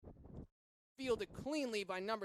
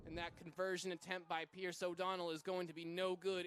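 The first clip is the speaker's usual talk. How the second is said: ordinary delivery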